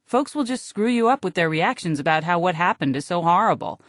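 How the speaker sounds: ordinary delivery, nothing marked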